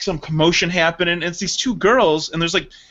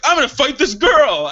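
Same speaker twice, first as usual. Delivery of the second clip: raised, loud bluster; mock fighting threat; 6 semitones higher, 4 dB louder than usual. Retelling himself held back by Sarah, mock threatening.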